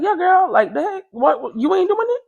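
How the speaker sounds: high, mocking imitation of the friend; lightly voiced girlfriend, teasing; friend's voice. Friend voiced in retold story.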